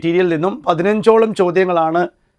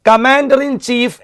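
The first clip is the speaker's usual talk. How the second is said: "Commander in chief" read slowly, word by word; teacher reads "Commander in chief" slowly; 4 semitones higher, much slower than usual. Shown as a demonstration. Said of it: "എവിടെയൊക്കെ നമുക്ക് plural ആക്കാം".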